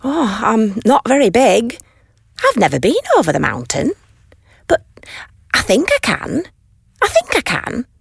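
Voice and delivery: little blue engine agreeing to try, lightly high; determined little engine. Acted. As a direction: talk yourself into it, little by little.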